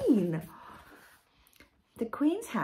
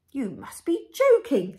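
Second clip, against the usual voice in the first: louder, raised, scoffing driver; sniffy disbelief; 5 semitones higher, 9 dB louder than usual. A narrator's reading. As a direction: scoff at the request. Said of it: "sniffed the driver".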